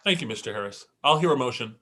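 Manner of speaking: normal talking voice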